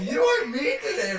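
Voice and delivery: high laughing complaint; giggling accusation. Real, not acted. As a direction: whine it through real laughter. Laughing at friend's teasing.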